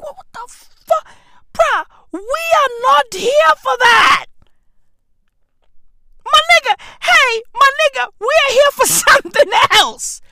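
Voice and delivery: very high, loud, indignant shouting; outraged protest. Retold or imitated. Patient retelling his protest to the doctor, high indignant yelling. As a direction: squawk in disbelief, then yell the protest.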